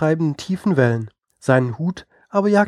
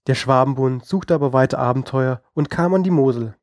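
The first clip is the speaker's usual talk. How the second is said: ordinary delivery, nothing marked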